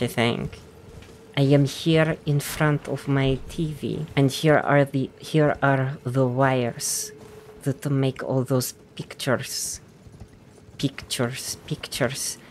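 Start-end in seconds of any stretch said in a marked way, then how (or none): 1.4-12.4 soft Icelandic accent, part Russian, part Irish; slow down and soften into amateur Icelandic; Icelandic accent attempt. Shown as a demonstration. Gamer testing Russian-Irish recipe, soft Icelandic accent. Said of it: "it's Russian with Irish".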